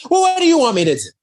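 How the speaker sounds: high, loud, fast acted retort; exasperated. Grace retorts to visitors asking for help, exasperated. Same reply redone, heightened.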